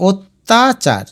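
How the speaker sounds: অত্যাচার split slowly as ot-ta-char; broken into syllables. Shown as a demonstration. Teacher dictating the spelling, অত্যাচার slowly split as ot-ta-char. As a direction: sound out অত্যাচার piece by piece. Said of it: "এটাকে লিখবে".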